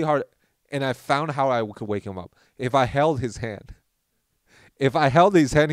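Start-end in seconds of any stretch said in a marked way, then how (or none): none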